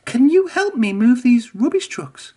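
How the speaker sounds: high, eager engine voice; tooting Emily. A narrator's reading. Emily asks Gordon and James for help, high. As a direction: toot the plea up high. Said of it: "tooted Emily".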